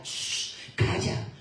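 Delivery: unvoiced shush; hushing. Crowd silencing the blind man, whispered shush. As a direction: hush him sharply under the breath.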